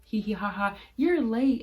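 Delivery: mock giggle into a loud, high jibe; teasing coworker. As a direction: imitate a coworker's playful ribbing. Coworker laughing off her lateness.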